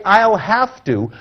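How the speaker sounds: slow, deliberate I'll have to; drawn out I'll have to; negative phrase. Shown as a demonstration. Speaker warning the audience off the phrase, slow. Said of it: "never to say".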